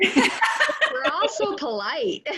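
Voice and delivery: said through laughter; laughing at the awkward pause; high giggly laughing remark. Real, not acted.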